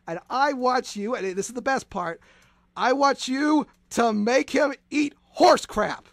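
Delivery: ordinary delivery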